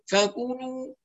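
"فكلوا" humming in the nose, like "fakuunuu"; nasalised vowels. Shown as a demonstration. Teacher shows what is incorrect, nasalised vowels. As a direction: let the u of "فكلوا" leak through the nose. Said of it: "هذا لا يصح".

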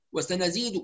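"وسنزيد" rushed with its short vowels clipped; snatched vowels. Shown as a demonstration. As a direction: hurry through "وسنزيد", swallowing the short vowels. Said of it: "وسنزيد هذا اختلاس". ("وسنزيد" باختلاس الحركات)

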